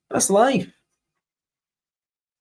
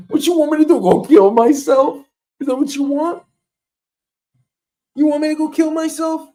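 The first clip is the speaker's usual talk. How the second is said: outraged shout; 10 semitones higher, 4 dB louder than usual. Acted. Outraged comic protest.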